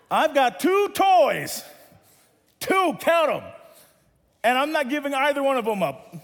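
mock-defiant kid digging in; stubborn refusal. Child refusing mom loudly.

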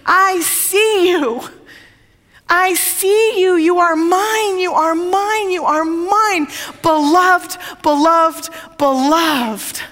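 high, drawn-out proclaiming call. Acted. God calling to Jesus from the torn-open heavens, proclaiming. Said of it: "to shout out to Jesus".

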